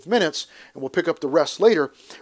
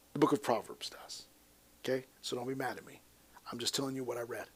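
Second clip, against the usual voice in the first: hushed aside; 5 semitones lower, 14 dB quieter than usual. Real, not acted. Teasing aside about Proverbs quote.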